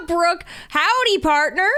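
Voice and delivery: a light, playful cowboy drawl; a hint of Southern cowboy, mild and jokey. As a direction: greet her like a friendly cowboy. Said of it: "You do sound like a cowboy".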